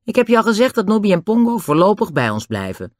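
put-on high-pitched, unruffled boy's voice; cool, defiant Dick. A narrator's reading. Dick tells Den that Nobby stays, high and defiant. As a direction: stand firm in a high young voice. Dick's voice in the story.

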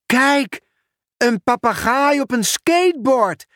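high, eager cry of discovery; excited call. Acted. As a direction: point it out to the class, amazed. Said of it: "riep Julian". (hoog en opgewonden roepen)

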